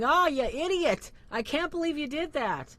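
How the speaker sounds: exasperated, high-pitched mock outburst; mock road-rage yelling. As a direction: act out yelling from behind the wheel. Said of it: "screaming and yelling".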